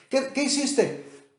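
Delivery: hammed-up confession scene; mock confessor. Preacher mocking priests who demand confession: hammed-up confessor. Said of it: "yo quiero escuchar toda tu vida".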